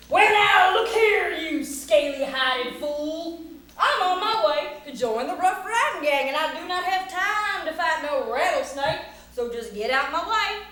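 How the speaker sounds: loud cowboy tirade, drawing out the insult; blustering challenge. Acted. Cowboy orders rattlesnake out of his way, blustering. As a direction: shout down the varmint with theatrical bluster.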